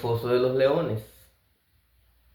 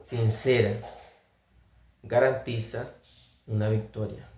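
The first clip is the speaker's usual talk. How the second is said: ordinary delivery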